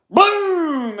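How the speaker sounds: loud, high mock gunshot boom. Telling how the patrolman shot the cow, a loud mock gunshot boom. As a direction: bark out the gunshot like a pistol going off.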